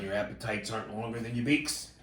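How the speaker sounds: ordinary talk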